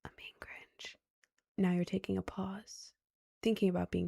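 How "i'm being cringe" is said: barely audible self-conscious whisper; whispered inner thought. Retold or imitated. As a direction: whisper it like a private worry.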